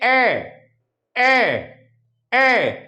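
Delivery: ए as a short e vowel, repeated; short e sound. Shown as a demonstration. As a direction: drill ए for the class. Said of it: "वो क्या होती है?".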